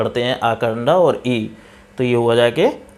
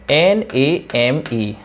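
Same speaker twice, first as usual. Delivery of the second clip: slow spelling; much slower than usual. Shown as a demonstration. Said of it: "spelling out letters".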